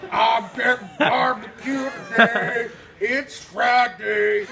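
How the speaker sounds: slow loud lumbering fat guy voice; mock booming customer voice. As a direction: bellow it out, slow and hungry. Mocking the fat man's imagined voice.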